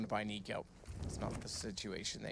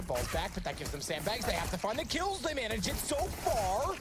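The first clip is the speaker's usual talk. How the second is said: hyped, much higher, louder call; esports caster; 10 semitones higher, 4 dB louder than usual. Real, not acted. Retake play-by-play.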